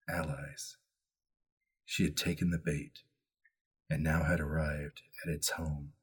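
soft, low storytelling voice with measured pauses; story narration. A narrator's reading. Keeper opens the mystery for the players, hushed story narration. Reads the scene-setting opening.

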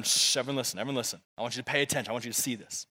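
low, soft call for attention; quiet the room and draw them in; hushing. Real, not acted. Hushing the congregation to focus.

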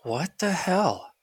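high, slow stunned "what the hell"; shocked disbelief. Real, not acted. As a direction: drag it out in disbelief. Reacting to outrageous shipping charge.

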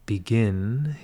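normal talking voice

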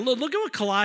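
ordinary talk, nothing marked

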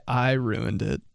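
ordinary talk, nothing marked

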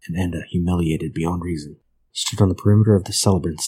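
usual speaking voice

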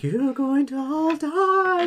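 very high, slow, drawn-out doom wail; menacing otherworldly voice. Retold or imitated. Game master echoing the player's threat, high mock-eerie wail. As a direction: imitate an eerie voice promising death.

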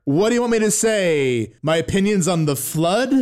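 loud, high mock indignation; mock put-upon. Host pressed for opinions on Halo lore, mock put-upon. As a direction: play up the exasperation, pitched high.

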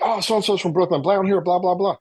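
eager inmate tip. Retold or imitated. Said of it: "I'll be like".

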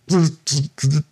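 high buzzing zzz like a darting fairy. Retold or imitated. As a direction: buzz it high, like a fairy zipping about. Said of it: "it was like".

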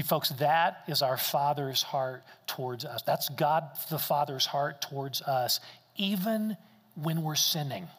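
ordinary talk

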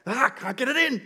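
high-pitched mock frustration; exasperated little-boy outburst; frustrated child. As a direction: imitate a kid fed up with his build. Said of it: "he starts getting frustrated".

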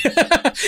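sudden high burst of laughter; high giggle. Real, not acted. Cohost laughing at his own jab, high giggle. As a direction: giggle high and breathy at the joke.

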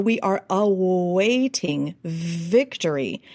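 put-on slow Western Ukrainian accent, w blended into v. Coach giving Zelensky's real line, Western Ukrainian accent. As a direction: slowly quote with the blended w-v sound. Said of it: "what he was saying".

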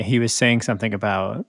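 normal talking voice

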